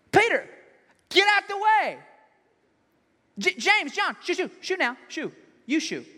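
loud, high, indignant shout; angry Jesus. Acted. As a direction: order them out of the way angrily. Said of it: "This is angry Jesus".

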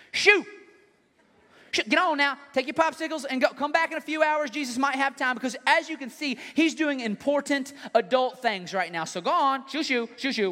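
loud, high dismissive disciple; shooing kids away. Acted.

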